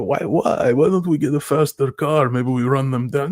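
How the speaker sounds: pleading in a cartoon Italian accent; Italian pizzeria guy. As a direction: whine the questions like a hammed-up Italian pizzeria worker. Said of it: "Italian guy, like a pizzeria".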